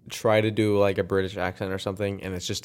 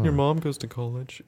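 higher, quieter nerdy Kip impression; 5 semitones higher, 4 dB quieter than usual. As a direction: whine it like Kip from the movie. Quoting Napoleon Dynamite movie.

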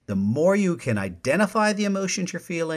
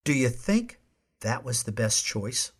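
softer, even, genuinely asking; calm response; 4 dB quieter than usual. Shown as a demonstration. Host demonstrating a calm response for listeners, soft and curious. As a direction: ask it gently, without blame. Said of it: "there was a difference in the way I said them".

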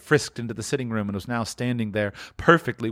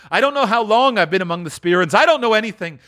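high, loud babbling; giddy excitement; 8 semitones higher, 5 dB louder, faster than usual. Acted. Scrooge back from the spirits, giddy high exclaiming. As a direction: exclaim it in happy bewilderment.

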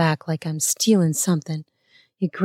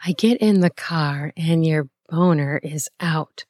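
ordinary delivery, nothing marked

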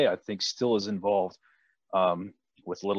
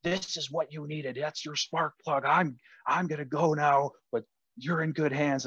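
a hint of the red-faced coach, higher; ejected coach's parting words; 6 semitones higher than usual. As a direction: play the coach calmly leaving after getting tossed. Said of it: "and he goes".

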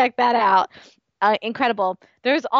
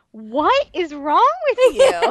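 mock exasperated boss; 9 semitones higher, 4 dB louder, slower than usual. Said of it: "her boss was like".

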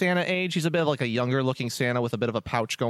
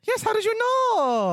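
high, bubbly elf voice; cheery high-pitched elf innkeeper welcome; jolly elf Festo; 3 dB louder than usual. Acted. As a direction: greet them like the jolliest elf alive.

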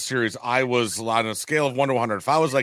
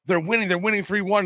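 excited report; 7 semitones higher than usual. Retold or imitated. Excited child reporting score to dad.

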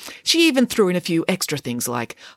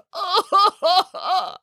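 sobbing laugh. Acted. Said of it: "And so did Boris".

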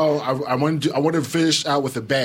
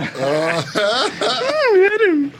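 loud high laugh; 14 semitones higher, 7 dB louder than usual. Real, not acted. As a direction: burst of high cackling laughter. Laughing at a dirty joke.

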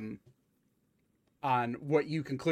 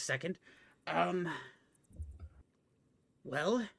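puzzled bookish nerd; thinking aloud like a nerd; 2 semitones higher, 3 dB quieter than usual. Acted. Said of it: "get my nerd voice on".

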